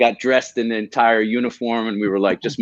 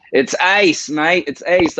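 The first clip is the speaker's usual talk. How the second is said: mock Aussie; 6 semitones higher, slower than usual. Slips into the Aussie slang.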